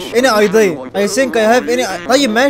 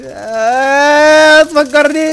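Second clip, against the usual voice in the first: long, loud, higher wail; mock crying; 7 semitones higher, 5 dB louder, much slower than usual. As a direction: wail it out like a hammed-up cry. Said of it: "تعيط بقى". (عياط مصطنع بصوت ممدود)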